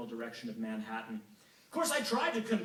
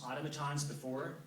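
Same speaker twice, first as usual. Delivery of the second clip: ordinary delivery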